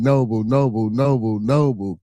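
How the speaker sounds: usual speaking voice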